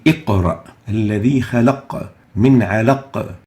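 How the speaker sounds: "اقرأ", "خلق", "علق" each with a bounced qaf; strong echoed q in "اقرأ الذي خلق من علق"; qalqala applied. Shown as a demonstration. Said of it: "implement the rule of qalqala, vibrating those letters".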